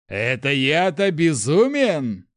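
high, slow, loud yell; outraged yell. A narrator's reading. Prince answering the crowd's accusation, outraged yell. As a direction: throw it back in outrage. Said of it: "заорал первый принц".

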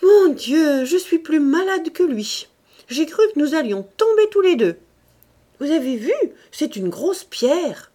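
high, loud, flustered society lady; fussing lady. A narrator's reading. Madame de Condamine fussing to prefect and president, high and loud. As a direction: gush about your own fright, breathless. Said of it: "répétait".

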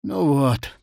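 mildly dejected, understated; hurt sigh. A narrator's reading. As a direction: sigh it with a touch of grievance. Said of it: "обиженно вздохнул Афанасий Профанасьевич".

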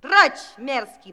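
angry shout. Acted. Fairy drives away the servant.

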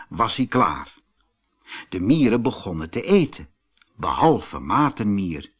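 plain reading voice

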